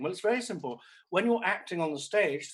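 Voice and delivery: usual speaking voice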